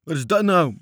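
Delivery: high, very loud roar; bellowing. A narrator's reading. Sailors roar at the stranded boat, bellowing. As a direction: bellow it in indignant surprise. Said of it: "brulden de matrozen".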